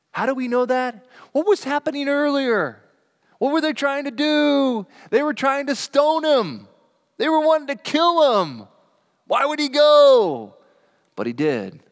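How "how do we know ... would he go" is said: much higher, louder, building preacher's cry; rising fervor. Acted. Preacher presses the congregation about the danger Jesus faced, fervent and climbing. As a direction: lift each question higher toward the climax.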